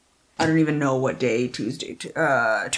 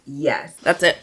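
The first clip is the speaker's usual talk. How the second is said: ordinary talk, nothing marked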